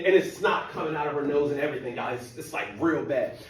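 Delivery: normal talking voice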